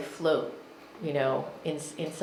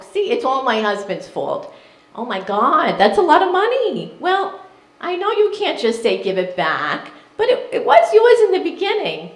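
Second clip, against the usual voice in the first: put-on mother's New York accent; 6 semitones higher, 7 dB louder than usual. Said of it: "so I'm gonna do the accent".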